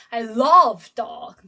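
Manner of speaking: long, loud "love" in the middle; stress on LOVE. Shown as a demonstration. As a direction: stretch and punch "love". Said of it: "I say the word love".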